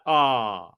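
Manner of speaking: a low, dull "Ah"; low "Ah" sound. Shown as a demonstration. Showing listeners the wrong Nevada vowel, a low "Ah".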